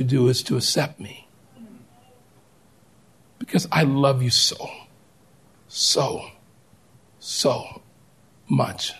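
soft, fading, near-whispered love; a loving plea to one child; God's tender voice. Retold or imitated. God speaking to a hurting listener, tender and soft.

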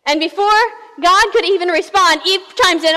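ordinary talk

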